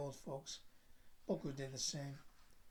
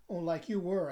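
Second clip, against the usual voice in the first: louder sarcastic retort; countering; 9 dB louder than usual. A narrator's reading. Narrator countering his cousin's accusation, pointed and louder. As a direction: throw it straight back at them. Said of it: "I countered".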